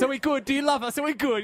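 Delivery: mock needy. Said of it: "they're so needy".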